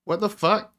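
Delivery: sudden high disbelieving swear; startled swear. Real, not acted. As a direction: blurt it out, caught off guard. Bug shows wrong spawn value.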